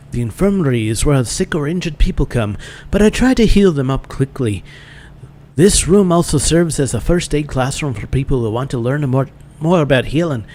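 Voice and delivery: Irish slipping into Scottish; accidental Scottish accent. Acted. Instructor explaining the infirmary, wobbly Scottish accent. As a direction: attempt Irish, land on bad Scottish. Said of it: "She's suddenly Scottish".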